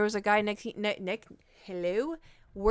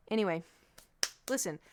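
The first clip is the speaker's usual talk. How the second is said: ordinary delivery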